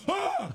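high mock gasp of terror; terrified gasp. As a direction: gasp like a horrified doctor, hammed up. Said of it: "like, terrified".

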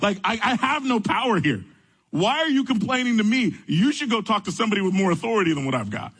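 retold plea of powerlessness, pitched high; high, exasperated self-defense, re-enacted; exasperated protest. Acts out his exasperated protest to complainer.

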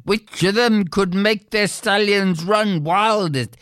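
ordinary delivery, nothing marked

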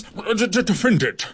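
stumbling, stammered start to the order; stammered defend it. Acted. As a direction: trip over the first sounds, then land it. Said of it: "in his most magisterial voice".

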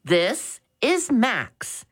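high and slow, polite; a polite boy on the phone; respectful answer. Acted. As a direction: speak slowly and respectfully to an elder. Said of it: "he respectfully answered".

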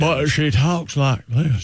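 slow Southern drawl; Southern accent. Acted. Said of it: "I'm using a Southern accent".

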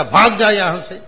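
loud sharp "get out"; angry shooing. Retold or imitated. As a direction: drive him away with a stick. Minister strikes and shoos. (गुस्से में भगाना, ऊंची आवाज़)

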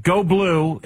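high-pitched folksy endorsement parody; mocking high-voiced testimonial; mock campaign-ad voice.

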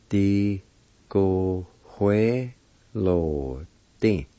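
"Di, go, hue, lo, di" with long gaps; syllable by syllable. Shown as a demonstration. Teacher showing how pencil is said, syllable by syllable. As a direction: sound out each syllable slowly. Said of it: "It's pronounced like this".

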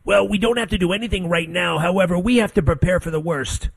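high-pitched mock expert answer; Fauci. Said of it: "You know, they said, So, uh, Doctor Fauci".

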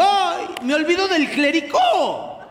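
put-on drunkenly remembering the punch; tipsy cry. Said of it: "y le digo".

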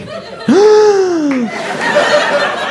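high laughing gasp of alarm; mock panicked gasp; shocked gasp. Sees caller name.

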